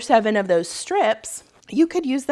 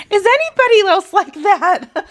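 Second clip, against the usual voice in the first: high, loud laughing question; real teary laugh, much higher and louder; laughing through tears; 12 semitones higher, 10 dB louder than usual. Real, not acted. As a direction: laugh it out, embarrassed after crying. Laughing after crying over daughter.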